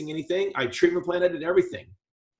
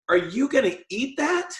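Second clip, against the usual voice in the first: "Are you gonna eat that?" pitched higher, with mock disgust on "that"; disgusted wife; 7 semitones higher than usual. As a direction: imitate a wife who finds the food gross. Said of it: "she has a different tone".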